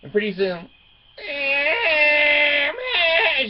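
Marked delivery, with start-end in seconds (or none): 1.2-3.5 put-on high, drawn-out baby wail; imitated baby crying. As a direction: mimic a baby's cry, loud and long.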